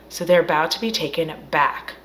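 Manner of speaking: ordinary delivery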